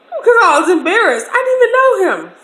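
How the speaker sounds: high, loud flustered rush with a gasp; panicked gasp. Retold or imitated. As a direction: gasp and blurt it out fast, high-pitched. Panicked embarrassment recalled.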